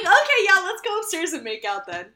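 high, loud burst of mock eagerness; hammed-up excited yes, starting loud and high; eager agreement. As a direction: imitate someone instantly won over. Imitating Bonnie's eager reply.

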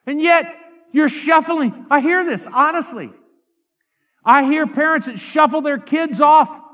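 high-pitched, insistent preacher's voice; fervent preaching. Real, not acted.

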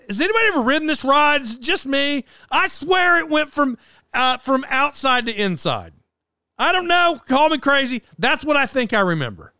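high-pitched, insistent appeal; worked-up, incredulous insistence; exasperated protest. Real, not acted. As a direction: plead your case, pitch jumping up. Protesting that his memory is right.

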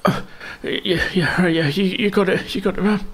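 high, hurried yes-ma'am voice; eager frightened bandit. Acted. Frightened bandit agreeing with Ruby. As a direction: scramble to agree so you're spared.

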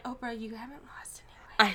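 fast hushed whisper; secret-telling whisper. Retold or imitated. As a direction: lean in and whisper it. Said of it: "And I'm like".

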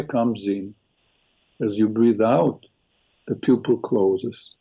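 slow, low guided meditation voice. Acted. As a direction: ease them into calm breathing. Leads a guided eye meditation.

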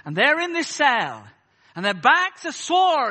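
loud, stretched-out revivalist delivery; mimicked preacher's declamation; imitating American preacher. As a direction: imitate an Afro-American preacher in full cry. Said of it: "and excuse the accent".

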